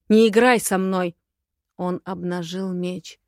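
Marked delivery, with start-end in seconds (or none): 0.1-1.2 loud, warning command; angry growl. A narrator's reading. Dragon warns the witch, loud growl. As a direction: growl it as a threat. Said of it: "зарычал дракон".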